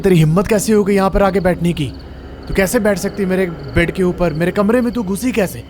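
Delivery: angry flatmate yelling at a guest; furious outburst. Acted. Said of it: "मीता एकदम भड़क के उठती है".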